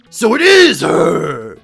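loud, drawn-out cry of recognition; loud realization. Acted. Professor recognizing Princess Peach, loud drawn-out exclamation. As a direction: burst out with it, stretched and booming.

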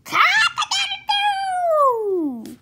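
long, very high, loud rooster crow; squawked crow. A narrator's reading. Cockerel crowing as it shoots up, loud squawk. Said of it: "squawked the cockerel".

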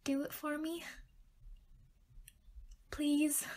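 small, high, soft pleading; begging. Pretend. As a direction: beg in a rapid, stuttering string of pleases. Begging partner to write script.

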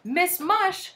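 high, loud announcement; bright-pitched lady caller; cheery visitor. A narrator's reading. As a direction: sing out your name through a door. Visitor announces herself through door.

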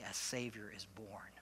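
hushed, voiceless whisper. Shown as a demonstration. Pastor showing the congregation what to tell a neighbor, whispered. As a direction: breathe it like a secret.

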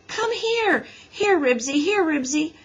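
high, pleading dog calls; plaintive, urgent calling; wailing. A narrator's reading. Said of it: "wailed Henry".

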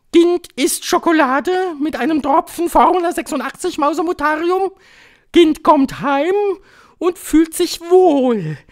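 very high, loud witch voice; piercing high proclamation; commanding Grand High Witch. A narrator's reading. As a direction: shout it as a domineering witch leader.

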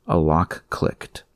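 plain reading voice

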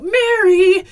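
loud, high, shocked cry. Acted. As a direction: call out the name in horror. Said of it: "cried out".

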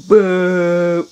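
a loud, high, forceful boo. Shown as a demonstration. Kid leading the viewers in booing school changes, loud. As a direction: throw out a big loud boo. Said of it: "That's how we go".

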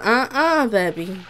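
ordinary talk, nothing marked

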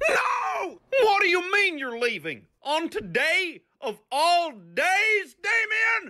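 high, hammed-up indignant shouting; mock outraged yell. Goofy yelling at Damien for leaving on his birthday, hammed-up outrage. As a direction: overplay the betrayal, pitch up.

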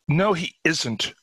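high, slow, insistent contradiction; acted vehement protest; glaring denial. A narrator's reading. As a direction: deny it fiercely, drawing it out. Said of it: "she glared up at him".

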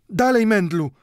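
high, loud urgent shout from the doorway; shouted call. A narrator's reading. Caretaker woman shouting from the doorway at old Mendel. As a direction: yell at him to hurry and hide. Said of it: "krzyknęła od progu stróżka".

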